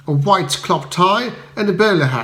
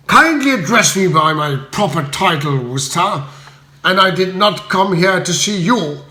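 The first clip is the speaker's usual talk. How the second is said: venomous; 3 semitones higher than usual. Acted. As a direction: spit it at him with contempt. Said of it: "he replied venomously".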